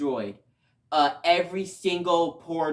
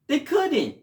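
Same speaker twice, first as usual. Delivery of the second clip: high, pitched-up cry of disbelief; indignant protest; 11 semitones higher than usual. Real, not acted. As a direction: insist in outrage they couldn't. Outraged protest about Max.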